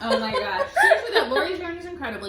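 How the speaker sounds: high, loud, laughing gush; laughing excitement. Real, not acted. Cohost raving about a friend's yarn giveaway, laughing. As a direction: burst out laughing as you rave.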